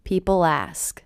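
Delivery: "People ask" run together through the L; "People ask" as one unbroken unit; linked L. Shown as a demonstration. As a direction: carry the L of "People" straight into "ask". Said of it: "linking to the beginning vowel".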